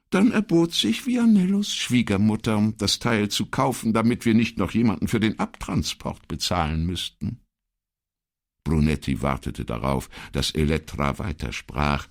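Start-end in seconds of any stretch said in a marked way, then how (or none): 0.1-7.4 high-pitched, emphatic secretary voice; insistent secretary. Acted. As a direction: set the record straight in a high voice. Voices Elettra in dialogue.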